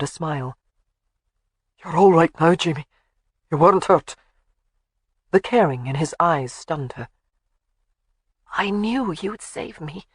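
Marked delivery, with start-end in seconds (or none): 1.8-4.1 lightly voiced Highland warrior; a hint of a gruff Scot; Scottish man. A narrator's reading. 8.5-10.1 soft slow trusting voice; tender. A narrator's reading. Jamie voiced softly.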